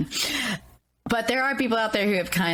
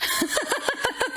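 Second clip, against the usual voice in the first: high laugh. Real, not acted. Laugh after Shakespeare joke.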